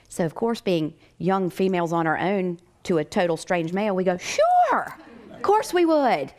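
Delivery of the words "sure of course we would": very high, drawn-out gushing yes; hammed-up naive enthusiasm; mock eagerness. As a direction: squeal agreement, stretching the words. Mock eager answer to a stranger.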